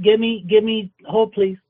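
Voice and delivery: ordinary delivery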